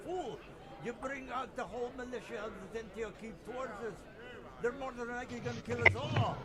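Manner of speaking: high, loud scolding voice far from his own; angry rebuke. Acted. Frightened villager berates the adventurers, high and angry. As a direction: snap at the fools who brought the militia. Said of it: "He says".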